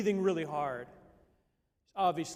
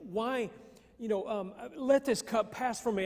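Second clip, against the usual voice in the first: raised, pleading cry that sinks into helplessness; a hint of desperate crying out; 5 semitones higher than usual. Preacher voicing Jesus crying out, lightly anguished. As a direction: cry out like a man at the end of himself. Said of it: "saying these things like".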